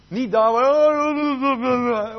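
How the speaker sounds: loud, high, drawn-out mock wail; mock screaming. Preacher imitating someone who starts screaming at God, mock holler. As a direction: stretch the last word into a hammed-up holler. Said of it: "oh, oh, hij begint te schreeuwen".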